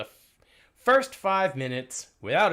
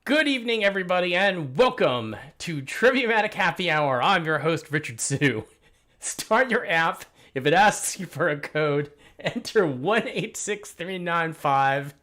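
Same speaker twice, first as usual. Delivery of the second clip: loud, upbeat host welcome; game show host; 4 semitones higher, 6 dB louder than usual. Acted. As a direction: announce it like a quiz show host. Host opens trivia show.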